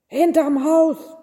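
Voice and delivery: irritated shout at callers; bellowing. A narrator's reading. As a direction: bellow it as an annoyed innkeeper. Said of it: "brüllte der Wirt".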